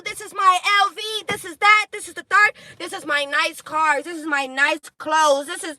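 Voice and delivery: high, loud mock braggart voice; mocking show-off. Reader mocking a show-off flexing online. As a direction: sneer at a flashy poser. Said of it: "that person is going online".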